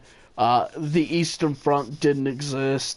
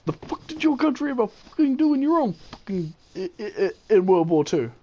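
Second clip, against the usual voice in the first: mock angry rant; 6 semitones higher than usual. Angry player berating a New Zealander in chat, mock sputtering rage. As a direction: imitate an irate gamer stammering through his rant. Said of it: "Someone seems very angry".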